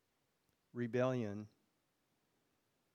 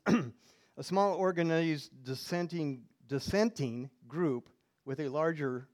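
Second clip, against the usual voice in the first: slow reading; 3 semitones higher, 7 dB louder, slower than usual. Real, not acted. Reads out a dictionary entry.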